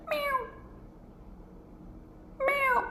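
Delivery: small, high, soft mew; calm cat meow. Retold or imitated. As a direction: imitate a contented little cat quietly. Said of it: "she was kinda fine".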